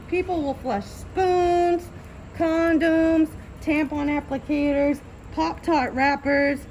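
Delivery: slow, disbelieving rundown; exasperated. Real, not acted. Exasperated list of flushed junk.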